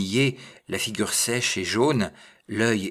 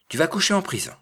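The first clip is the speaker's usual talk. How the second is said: high-pitched, forceful threat; threatening exclamation; 10 semitones higher, 4 dB louder, much faster than usual. A narrator's reading. Bourgmestre seizing the little man, threatening outburst.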